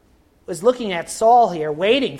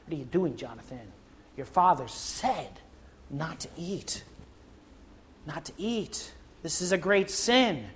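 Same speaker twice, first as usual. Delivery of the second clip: soldiers' hushed warning; 10 dB quieter than usual. Acted. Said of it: "the soldiers come around and say".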